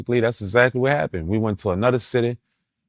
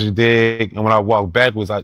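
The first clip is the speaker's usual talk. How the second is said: ordinary delivery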